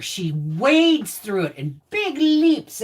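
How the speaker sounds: ordinary talk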